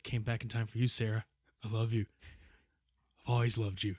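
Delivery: a hint of a soft movie declaration; Terminator love line. Friend parodying the Terminator scene, lightly voiced and soft. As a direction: softly ham the romantic movie line. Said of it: "the whole Terminator thing".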